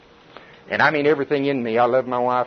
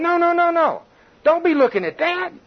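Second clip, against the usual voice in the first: mocking devil voice; 13 semitones higher than usual. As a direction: nag like a sneering devil. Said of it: "the devil's going".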